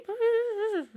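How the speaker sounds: a sudden high burst of laughter; high amused laugh. Real, not acted. Player laughing at the lax rocket security, high laugh. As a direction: laugh out loud, high and bright.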